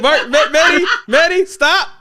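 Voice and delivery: loud, laughing call to stop; laughing shout. Real, not acted. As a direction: shout his name through a laugh. Yelling at cohost to stop.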